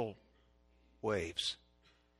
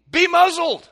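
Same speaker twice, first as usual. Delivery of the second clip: very high, loud bellow; full-force commanding shout; 17 dB louder than usual. Acted. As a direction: roar the order at wind and waves. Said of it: "he says to the wind and the waves".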